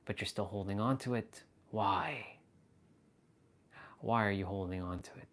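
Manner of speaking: hushed, probing talk straight at the listener; quiet, searching guru-like challenge; intense spiritual address. Shown as a demonstration.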